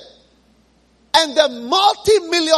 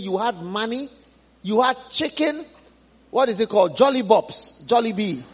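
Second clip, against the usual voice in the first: gently but firmly set him straight; Abraham telling the rich man things have changed; calm Abraham; 4 semitones lower than usual. Acted.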